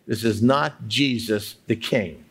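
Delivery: usual speaking voice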